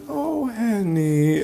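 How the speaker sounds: slow, soft, lightly voiced old lady; a gentle hint of an elderly woman. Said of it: "in an old lady voice".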